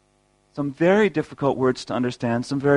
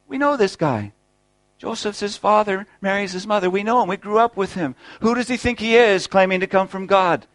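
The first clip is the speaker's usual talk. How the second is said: higher, scoffing townsfolk voice; mock scoffing crowd; 6 semitones higher than usual. Preacher voicing the hometown crowd doubting Jesus, higher and scoffing. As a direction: sneer it like neighbours who watched him grow up.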